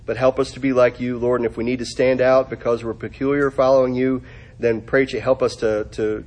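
low, even praying voice; subdued, level pastor's prayer; closing prayer. Real, not acted.

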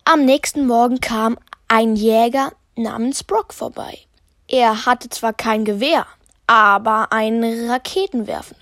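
ordinary delivery

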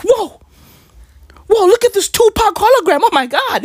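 put-on high, wide-eyed kid voice gaping at a hologram; amazed kids.

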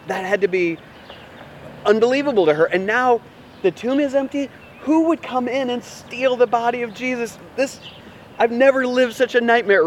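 a preacher caught up in Mary's shock, voice rising; rising disbelief. Acted. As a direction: voice climbing in shocked wonder.

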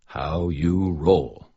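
slow, self-satisfied "How you roll."; proud cool phrase. A narrator's reading. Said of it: "I heard Molly say it once, so it must be cool".

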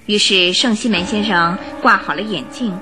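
ordinary delivery

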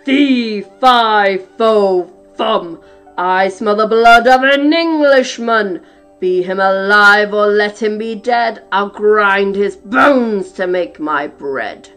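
loud, slow roaring giant; booming giant's war cry; roared. A narrator's reading. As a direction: roar it like a hungry giant. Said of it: "he roared out".

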